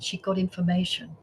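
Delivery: ordinary talk, nothing marked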